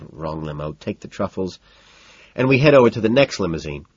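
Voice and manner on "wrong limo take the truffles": low, soft mumble; mumbling. A narrator's reading. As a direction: mumble it under your breath. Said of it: "mumbling by way of an explanation under my breath".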